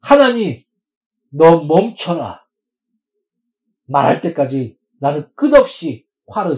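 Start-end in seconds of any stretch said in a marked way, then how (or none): 0.0-2.5 loud, slow, drawn-out cry; loud, slow, insistent plea; bold prayer shout. Acted. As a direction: call it out to God slowly.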